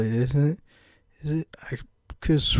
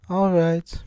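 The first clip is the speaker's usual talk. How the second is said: higher British lady voice saying "All right"; British accent; 6 semitones higher than usual. Acted. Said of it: "can't shake this British accent".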